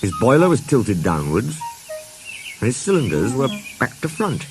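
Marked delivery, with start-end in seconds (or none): none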